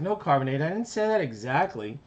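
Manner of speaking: mildly flustered cartoon big brother. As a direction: hurry to take it back. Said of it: "And he's like".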